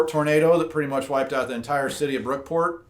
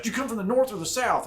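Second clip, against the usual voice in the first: landowner voice; 8 semitones higher, faster than usual. Retold or imitated. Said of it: "He's like".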